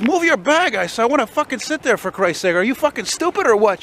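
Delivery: high-pitched imitation of an irate passenger; mock losing it. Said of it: "she fucking loses it on the guy".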